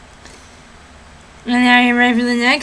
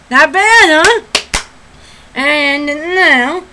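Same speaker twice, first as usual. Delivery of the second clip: normal talking voice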